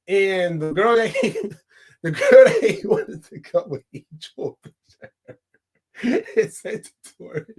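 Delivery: trying to talk through laughter; words breaking up with giggles; real laughing fit. Real, not acted. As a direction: crack up while telling the story. Cracking up while retelling film scene.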